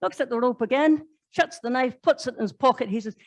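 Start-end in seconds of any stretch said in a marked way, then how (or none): none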